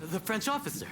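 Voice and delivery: high, eager surprise; surprised recognition. Acted. Fukuzawa recognizing the French officer, surprised. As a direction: catch him before he strikes.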